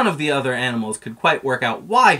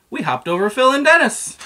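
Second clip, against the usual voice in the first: excited; 13 semitones higher, 7 dB louder than usual. A narrator's reading. Excited high line about bug friends.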